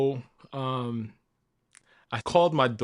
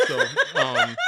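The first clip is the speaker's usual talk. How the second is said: breaking into laughter; 4 dB louder than usual. Real, not acted. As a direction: voice jumps high into a laugh.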